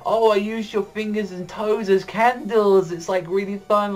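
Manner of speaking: high sweet-creepy sister voice; creepy cheerful sister. Retold or imitated. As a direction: sound delighted by something gruesome. Sister boasts about candles.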